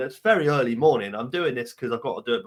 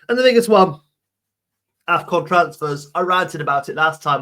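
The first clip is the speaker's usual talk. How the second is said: rant opener; 6 semitones higher, 7 dB louder than usual. Real, not acted. Ranting, leading into a pet peeve.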